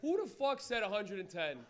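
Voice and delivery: ordinary talk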